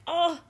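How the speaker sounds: short high, loud "oh" of pain; pained cry. Retold or imitated. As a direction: yelp as the foot gives out. Tries stepping on hurt foot.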